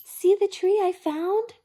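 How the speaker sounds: high, loud call; excited cry. A narrator's reading. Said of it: "he cried".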